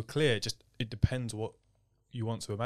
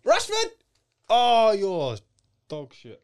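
much higher, loud football cheer; excited shout; 8 semitones higher, 7 dB louder than usual. Real, not acted. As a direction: yell at the telly as England attack. Reacting to football on screen.

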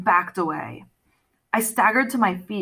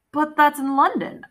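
protesting; 6 semitones higher than usual. Acted. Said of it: "I protested".

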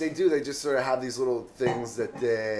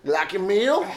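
mock Southern; 8 semitones higher, 8 dB louder than usual. Horse-riding Southern lady asks diner about meal, mock Southern. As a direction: holler it like a hammed-up Southern rider. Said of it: "she came up on a horse".

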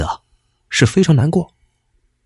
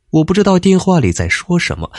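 ordinary delivery

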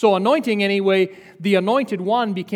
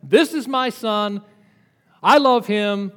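God booming from heaven; 3 dB louder, slower than usual. Retold or imitated. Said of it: "God shouts from heaven".

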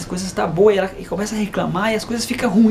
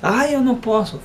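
put-on higher, sighing child's protest; mock plaintive refusal; imitated reluctant daughter; 5 semitones higher than usual. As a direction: play a discouraged child, lightly.

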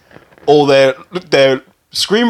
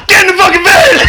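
higher, louder mock yell through laughter; laughing mock shout; 5 dB louder, faster than usual. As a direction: bellow it like a creep, cracking up. Van man shouting, laughing.

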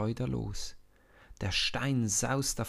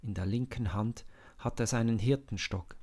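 usual speaking voice

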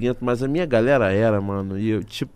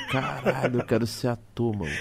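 laughing; 6 dB quieter than usual. Retold or imitated. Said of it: "Aí eu falei".

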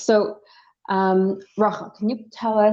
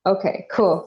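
ordinary talk, nothing marked

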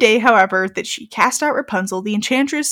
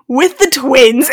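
mock narrator emphasis; 2 semitones higher, 6 dB louder, slower than usual. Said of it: "he was like".

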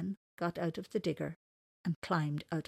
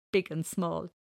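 usual speaking voice

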